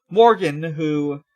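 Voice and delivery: normal talking voice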